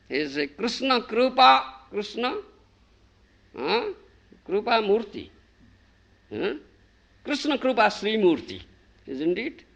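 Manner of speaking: usual speaking voice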